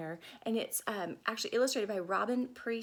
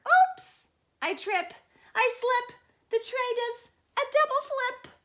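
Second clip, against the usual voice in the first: much higher, loud escalating yelps; slapstick alarm; 11 semitones higher, 9 dB louder than usual. Acted. Nancy tripping with the tray, high and loud. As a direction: yelp through the tumble.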